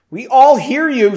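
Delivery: loud, high call across rooms; yell. A narrator's reading. Erin calling to the pair next door, yelling. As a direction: holler it so next door hears. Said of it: "she yelled".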